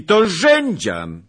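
high, loud, drawn-out cry of recognition; surprised exclamation. Acted. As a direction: exclaim as an unexpected friend appears. (zdumiony okrzyk)

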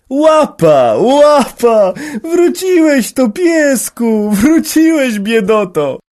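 high, loud joyful calling to a dog; radosny okrzyk. A narrator's reading. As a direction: cry out with delight at the returned dog. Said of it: "Wykrzykiwał". (radosne wołanie)

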